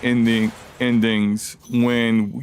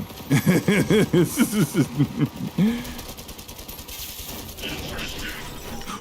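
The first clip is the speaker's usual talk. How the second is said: breathy laugh; 9 semitones higher, 3 dB quieter than usual. Real, not acted. Laugh after fight.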